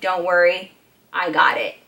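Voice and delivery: low, steady reassurance; real calm. Retold or imitated. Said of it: "put the blanket down real calm".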